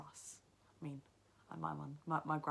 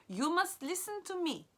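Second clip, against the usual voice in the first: much higher, insistent grandmother; 13 semitones higher, 7 dB louder than usual. Acted.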